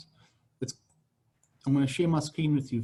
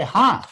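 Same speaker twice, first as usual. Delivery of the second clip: Hi said high and loud, then a pause; a cheerful Hi to catch attention; bright opening hi; 6 semitones higher, 6 dB louder than usual. Shown as a demonstration. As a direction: call out Hi and stop. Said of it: "So what you gotta do is say".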